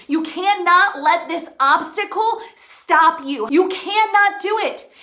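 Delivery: ordinary delivery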